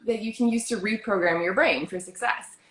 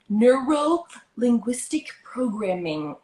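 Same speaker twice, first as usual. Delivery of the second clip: slow and deliberate; 4 semitones higher, slower than usual. Shown as a demonstration. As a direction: spell it out slowly. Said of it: "I'm saying that slow".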